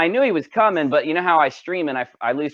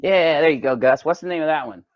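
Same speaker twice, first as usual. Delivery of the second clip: ordinary talk, nothing marked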